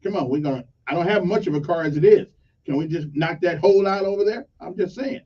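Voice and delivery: ordinary talk, nothing marked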